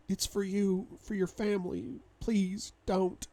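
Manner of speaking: high, halting plea; pleading woman's voice. Acted. Wife's words remembered by husband, high pleading. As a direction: plead in a high voice with breaks between phrases.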